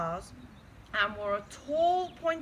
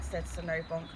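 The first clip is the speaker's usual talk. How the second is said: plain reading voice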